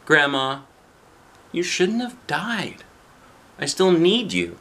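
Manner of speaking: put-on high-pitched child's plea; pleading grandchild. Boy talks to his late grandma in imagination, high child's pleading. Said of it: "talking to his grandma in his imagination".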